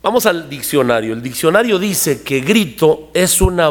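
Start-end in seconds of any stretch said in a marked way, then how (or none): none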